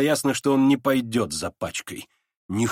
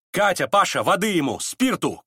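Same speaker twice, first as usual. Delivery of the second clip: raised, urgent call; Настасья Филипповна; 6 semitones higher than usual. A narrator's reading. Настасья Филипповна calls the maids for water, urgent shout. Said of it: "крикнула Настасья Филипповна".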